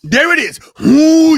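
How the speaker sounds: loud, high wrestling hype; wrestler promo voice. Pretend. Host breaking into a bit for co-host, wrestler promo voice.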